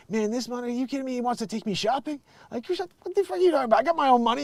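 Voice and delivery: high, indignant mock rant. Rapper scoffing at a shopping offer, mock indignant. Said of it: "this video where he's like".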